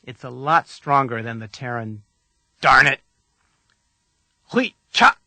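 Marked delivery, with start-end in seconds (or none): 2.6-3.0 louder, forceful curse; strong force. Shown as a demonstration. Teacher quotes the Terran equivalent of a curse, forcefully. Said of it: "another word with strong force". 4.5-5.2 Hui cha higher and louder, both syllables cut short; strong force. Shown as a demonstration. Teacher models a Klingon curse, Hui cha sharp and forceful. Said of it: "another word with strong force".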